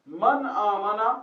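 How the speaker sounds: slow, emphatic teacher's prompt; very slow recitation. Shown as a demonstration. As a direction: hold the words out to prompt the class. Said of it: "मन और मा में फर्क".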